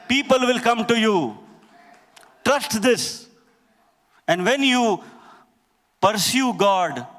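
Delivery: high, urgent sermon delivery; impassioned preaching. Real, not acted. Preacher urging brothers and sisters to pursue God, impassioned. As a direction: preach it with rising force, pitch lifted.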